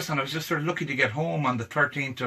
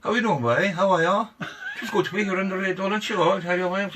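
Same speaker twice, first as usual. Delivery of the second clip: put-on light, laughing Cork accent; a cheery hint of Cork, grinning; 4 semitones higher than usual. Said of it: "Just give us a Cork accent there now".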